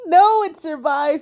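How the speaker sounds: high, drawn-out wail of dismay; slow, high disappointed whine; dismayed cry. Real, not acted. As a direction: cry out as the win slips away. Opponent survives his attack.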